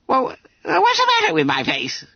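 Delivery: high-pitched squeaky protest; exclaimed. A narrator's reading. Bunter defends his face to the master, exclaiming. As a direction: exclaim it as an affronted schoolboy. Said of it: "he exclaimed".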